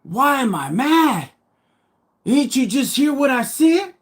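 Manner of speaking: high, exasperated retort; mad, incredulous. Retold or imitated. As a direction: throw the question back in retold disbelief. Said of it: "So why are you mad".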